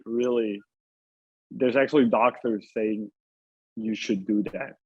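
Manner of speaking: normal talking voice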